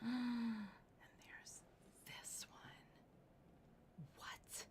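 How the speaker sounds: gasp, then hushed whisper; breathless whispered wonder; awed whisper. Real, not acted. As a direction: whisper the reveal in awe. Host reveals final ring.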